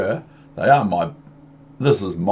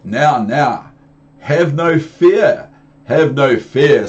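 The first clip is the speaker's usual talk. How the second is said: booming cat; 4 semitones higher, 7 dB louder, slower than usual. Acted. Cat reassures the children.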